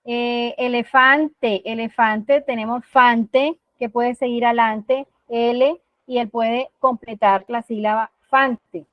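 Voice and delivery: elefante stretched out slowly, then fante slow; slow word segmenting. Shown as a demonstration. Therapist splitting elefante for a child: slow, drawn-out syllables. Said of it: "uno puede empezar a trabajar".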